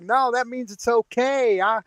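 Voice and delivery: high, slow and soft mock Southern girl; mock Southern girlfriend. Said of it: "she's like".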